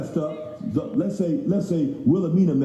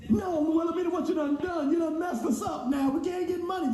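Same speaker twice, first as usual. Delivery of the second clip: mock scolding; 12 semitones higher than usual. Customer scolding his failed company in a high mock voice. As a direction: chide her like a fed-up auntie.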